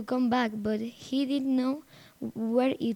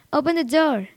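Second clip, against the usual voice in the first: loud plea to be let in; crying out; 3 semitones higher, 7 dB louder than usual. A narrator's reading. Lost boy calling to his aunt, crying out. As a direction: call out firmly through the door.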